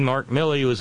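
ordinary talk, nothing marked

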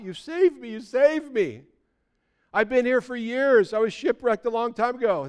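high, loud, breathless joy at being rescued; overjoyed castaway. Acted. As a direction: gush it out like a man just saved. Rescued castaway greets the Coast Guard.

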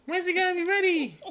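put-on high, loud whining kid; whiny kid voice. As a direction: whine it like an impatient child. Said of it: "they done come in to interrupt".

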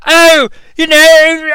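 put-on high, loud goofy kid voice; little fat kid voice. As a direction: play a whiny overexcited kid. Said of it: "I can't even do an impression of him".

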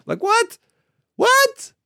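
high squeaked what, what; incredulous high squeak; squeaky disbelief. Real, not acted. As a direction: shriek of disbelief. Incredulous at selfie culture.

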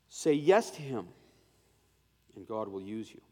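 ordinary delivery

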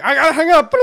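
high, loud, flustered blurt; laughing through a hasty sign-off; mock panic. Player cutting off the call, mock-flustered. Hurriedly ending the disastrous call.

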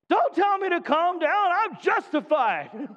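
mock indignant. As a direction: snap back like a frustrated man being told to calm down. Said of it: "The opposite".

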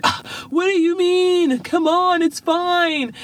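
high, whiny sixth grader brushing it off; whiny kid. Retold or imitated. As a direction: whine that it's fine already. Daughter whining back at mom.

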